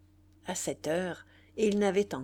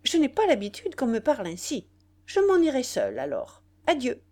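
cold anger; 4 semitones higher, 6 dB louder than usual. A narrator's reading. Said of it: "avec une colère froide".

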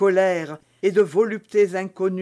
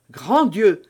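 louder shocked exclamation; cry out; 3 semitones higher, 6 dB louder than usual. Acted. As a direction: gasp it out at the sight of the knife. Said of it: "s'écria Felton".

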